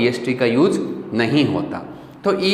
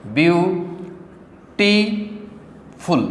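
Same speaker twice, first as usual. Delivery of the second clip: Beautiful split into three drawn-out syllables with long pauses; 4 semitones higher, much slower than usual. Shown as a demonstration. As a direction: say view... टी... फुल very slowly. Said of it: "तीन syllable हो गए". (Beautiful को तोड़कर, धीरे-धीरे)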